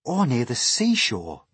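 mildly eager suggestion. A narrator's reading. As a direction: chime in hopefully. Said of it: "said James eagerly".

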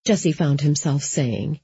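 usual speaking voice

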